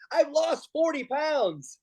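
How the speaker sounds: high and slow deadpan; Andy Kindler impression. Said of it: "he goes".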